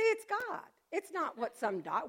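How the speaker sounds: ordinary talk, nothing marked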